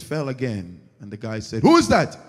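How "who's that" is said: loud high challenge; guard shouts into the dark shop; calling out. Acted. Guard hears a noise again, loud challenge.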